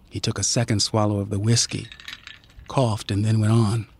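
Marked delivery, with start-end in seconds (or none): none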